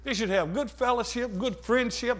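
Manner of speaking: ordinary talk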